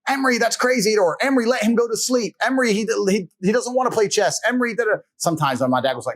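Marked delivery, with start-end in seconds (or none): 0.1-4.9 high pleading mother; pleading mom. Retold or imitated. Mother begging dad to let the boy sleep, high pleading. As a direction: whine it up like the protesting mom. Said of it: "my mother trying to be like".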